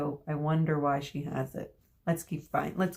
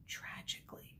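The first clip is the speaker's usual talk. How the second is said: breathy whispered aside; 20 dB quieter than usual. A narrator's reading. Reader adding a gloomy joke, whispered.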